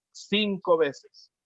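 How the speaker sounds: "Cinco" slow and weighty, each syllable spaced; stress on cinco. Shown as a demonstration. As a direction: dwell on "Cinco veces" slowly. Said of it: "lo menciona cinco veces". ("Cinco" lento y marcado)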